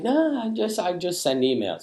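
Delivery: high-pitched mock brush-off; breezy high voice waving it away; dismissive nah. To people texting him, a high dismissive brush-off. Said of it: "I was like".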